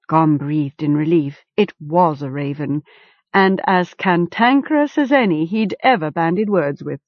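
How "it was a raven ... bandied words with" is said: ordinary delivery, nothing marked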